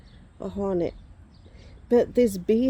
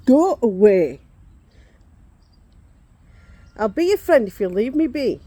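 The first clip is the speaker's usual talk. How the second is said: louder pleading at the bee, half laughing; loud shoo; 4 semitones higher, 11 dB louder than usual. Real, not acted. Woman pleading with a returning bee, loud. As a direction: beg the bee to leave, loudly.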